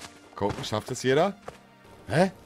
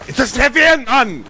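berserk rage; 11 semitones higher, 5 dB louder than usual. Real, not acted. Frustrated outburst at game.

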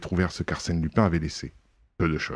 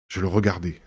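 ordinary delivery, nothing marked

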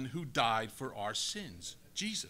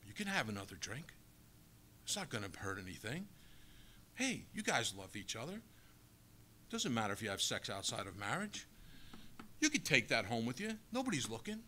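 hushed, coaxing tempter murmuring much softer; tempter's whisper; 4 semitones lower, 7 dB quieter than usual. Acted. The enemy tempting us into sin, softly coaxing. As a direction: lure them in softly, like the devil at your ear. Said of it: "That's what he whispers in our ear".

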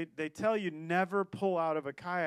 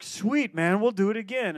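bright, higher, louder hype; excited cheer; 3 semitones higher, 8 dB louder than usual. Retold or imitated. Kayakers hyping the new guy, excited cheer. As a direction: pump him up like a buddy.

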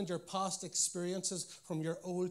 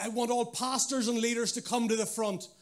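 louder, higher Northern Irish interpreter calling pastors forward; Northern Irish accent; 6 semitones higher, 8 dB louder than usual. Pretend. Interpreter summons pastors and leaders: loud Northern Irish accent. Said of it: "let's pretend the interpreter had a Northern Irish accent".

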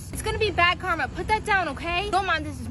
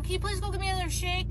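usual speaking voice

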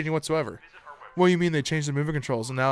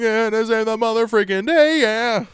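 hammed-up flag-waving hero, slow and higher; mock patriotic American; 5 semitones higher, 5 dB louder, slower than usual. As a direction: boom it out like a cheesy action-movie patriot. Patriotic American parody after accents talk.

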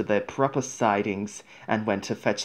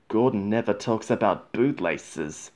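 ordinary delivery

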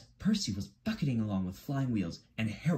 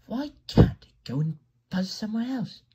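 high, complaining little engine; Percy; 12 semitones higher, 4 dB louder than usual. A narrator's reading. Percy grumbling about the noisy helicopter, high and annoyed. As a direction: whine it high in a character voice.

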